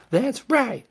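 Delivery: very high, loud women agreeing; a high cheerful chorus. A narrator's reading. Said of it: "said a chorus of women's voices".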